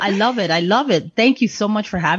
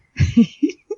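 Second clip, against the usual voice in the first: laugh; 9 semitones higher than usual. Real, not acted. Laughs after teasing co-host.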